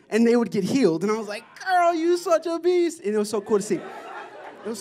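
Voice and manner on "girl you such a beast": high, drawn-out gush of praise; admiring exclamation. Retold or imitated. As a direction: hype her up, stretched and high-pitched. Gushing mock exclamation to friend.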